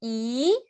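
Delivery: Y gliding upward; second tone. Shown as a demonstration. Teacher showing second tone, Y rising. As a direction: lift "Y" steadily like a question.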